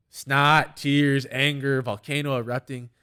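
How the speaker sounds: loud, high punched list, easing off; retold outburst. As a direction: punch each word loudly like a meltdown building up. Acting out a childhood meltdown.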